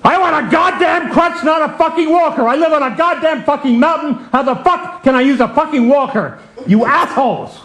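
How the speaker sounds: high, swearing complaint; cranky ranting. Retold or imitated. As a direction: rant like a grumpy patient. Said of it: "the crankiest patient".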